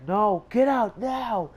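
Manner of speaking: high, loud, slow command; shouted order with stretched words; stern guard's shout. Retold or imitated. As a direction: imitate a guard shouting them out. Guard repeats order, retold.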